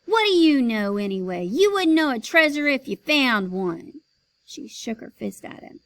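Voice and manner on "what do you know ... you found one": loud, rising angry yell; mother yelling at the big man, angry. Acted. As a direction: yell back at him. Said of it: "His mother yelled at the big man".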